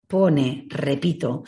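ordinary delivery

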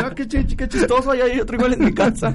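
mock clueless official. Mocking the official's excuse.

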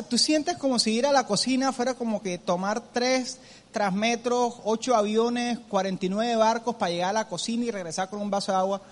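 ordinary delivery

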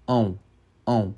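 "ão" nasal, mouth closing on the glide. Shown as a demonstration. Teacher drilling the nasal vowel for students, nasal ão. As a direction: push "ão" through the nose, closing the lips. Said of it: "Ão, fecha a boca".

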